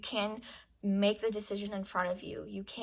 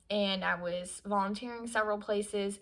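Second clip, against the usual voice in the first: ordinary talk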